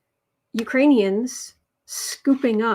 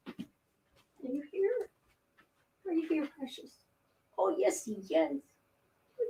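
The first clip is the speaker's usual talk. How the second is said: cooing to cat; 4 semitones higher, 6 dB quieter than usual. Real, not acted. Owner cooing softly to her sleepy cat. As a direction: coo at the kitty tenderly.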